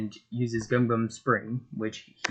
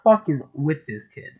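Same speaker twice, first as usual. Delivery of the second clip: angry disbelief; 5 semitones higher, 8 dB louder than usual. Acted. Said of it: "As Buggy's like".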